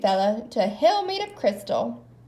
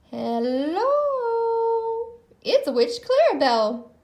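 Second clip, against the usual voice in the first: witch voice; 10 semitones higher than usual. A narrator's reading. Witch greets visitors.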